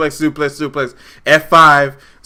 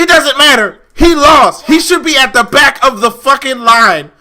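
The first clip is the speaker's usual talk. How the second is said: high, loud rant; real exasperated shouting; heated objection; 5 semitones higher, 4 dB louder than usual. Real, not acted. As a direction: shout down the argument.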